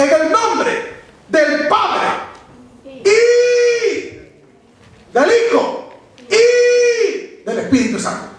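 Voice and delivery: each Y held long and high, set off by pauses; slow formula with soaring, drawn-out Y; stress on each Y. Shown as a demonstration. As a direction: hold Y on a high sustained note between names. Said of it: "Hace una distinción".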